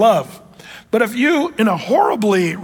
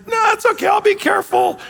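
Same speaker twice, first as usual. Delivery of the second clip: put-on much higher boyish shout; kid shouting back. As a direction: yell it back like a stubborn little boy. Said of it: "And, and he said".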